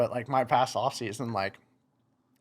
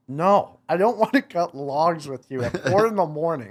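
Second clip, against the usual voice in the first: loud, higher-pitched retold protest; emphatic refusal; 6 semitones higher, 6 dB louder than usual. Son refusing dad's dawn log cutting, loud emphatic refusal. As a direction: push back hard, half laughing.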